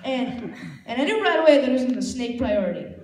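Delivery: normal talking voice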